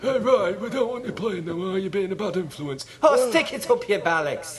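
high, loud hammed-up Irish quarrel; Keane and McCarthy acted. McCarthy scolding Roy, Roy snapping back loudly. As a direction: shout both sides of a row. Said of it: "Wasn't that Roy Keane said that?".

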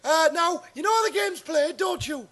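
bright TV presenter welcoming the audience, pitched high; Paul Daniels impression. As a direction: showman host on a Saturday night quiz. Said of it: "I'm Paul Daniels".

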